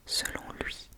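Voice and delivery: plain reading voice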